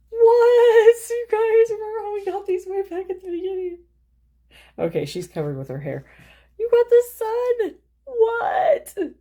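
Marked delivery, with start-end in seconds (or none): 0.1-3.8 loud, very high squeal of disbelief; astonished outburst. Real, not acted. Reader shocked by the card she just drew, astonished squeal. 6.6-9.1 very high, loud, squealing cry; thrilled shout. Real, not acted. Reader telling viewers they got the sun, thrilled shout.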